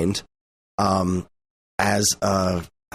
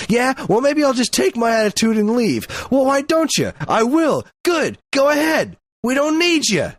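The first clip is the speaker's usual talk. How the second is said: hammed-up soap opera spat; mock shouting match; 14 semitones higher than usual. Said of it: "it's like moonlighting".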